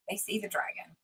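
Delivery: hushed whisper, full of wonder. Acted. Telling the guest what kids imagine, whispered in awe. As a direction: breathe it like a secret.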